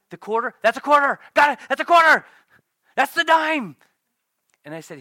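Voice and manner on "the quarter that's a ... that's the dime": much higher and louder shouting; mock triumphant student yells; triumphant answers.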